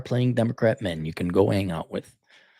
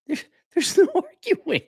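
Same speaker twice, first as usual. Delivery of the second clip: high laughing squeal, much higher. Acted. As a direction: laugh through the rule up high. Laughing high-pitched rule.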